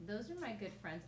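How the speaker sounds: ordinary talk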